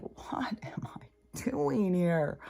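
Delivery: slow breathy whisper to herself; slow, secret whisper of doubt; whispered inner thought. Retold or imitated. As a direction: hush it like a private panic. Inner panic quoted, whispered aside.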